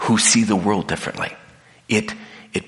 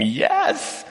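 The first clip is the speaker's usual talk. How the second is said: much higher, thrilled yes; delighted exclamation; excited yes. Retold or imitated. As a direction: cheer it out. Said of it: "I'm going".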